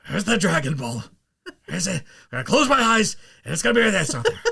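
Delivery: cartoon villain building to high, loud outrage; hammed-up anime villain rant; Vegeta. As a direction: start measured, climb into strained shouting. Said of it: "Vegeta's spot on. Sounds just like Chris Sabat".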